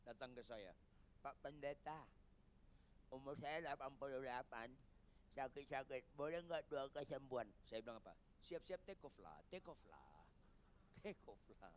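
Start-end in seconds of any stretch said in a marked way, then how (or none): 1.2-7.5 put-on elderly retired pastor. Retired pastor asking about healing prayer, old man's voice. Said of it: "Ada pendeta sudah emeritus". 8.4-11.7 soft, low, fading repetition; hushed reply. Retold or imitated. Pastor telling aged colleague to get ready, hushed and low.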